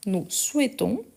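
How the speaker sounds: ordinary delivery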